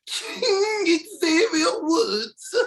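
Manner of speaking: slow, high royal proclamation; drawn-out high proclamation; proclaiming. Real, not acted. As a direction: herald the king. Proclaiming the king.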